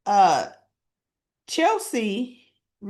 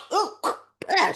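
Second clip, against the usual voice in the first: mock gagging "ugh", high-pitched; disgusted spit; 9 semitones higher than usual. Naomi tasting a bad cupcake, mock disgusted gag. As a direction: imitate spitting out a bad bite. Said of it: "she spits it out".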